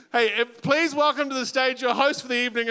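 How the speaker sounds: ordinary talk, nothing marked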